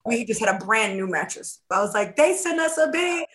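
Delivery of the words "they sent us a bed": high, retold cry of outrage; outraged exclamation. Acting out outrage at new bed.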